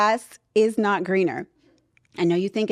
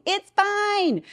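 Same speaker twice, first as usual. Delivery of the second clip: much higher, sing-song reassurance; reassuring; 10 semitones higher than usual. Real, not acted. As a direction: brush it off brightly.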